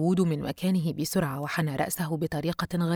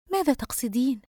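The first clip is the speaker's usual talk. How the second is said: mildly wary, higher-pitched question; apprehensive; 7 semitones higher than usual. A narrator's reading. Friend questions Yusra's meaning, mildly apprehensive. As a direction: ask with a touch of unease. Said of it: "دينا بتوجس".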